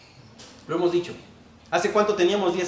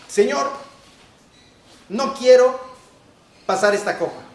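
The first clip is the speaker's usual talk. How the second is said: loud, higher demanding plea to God; demanding prayer; 5 semitones higher, 6 dB louder than usual. Shown as a demonstration. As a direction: insist on your own will, not begging. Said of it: "¿Está diciendo eso? No". (exigiendo a Dios, más fuerte y agudo)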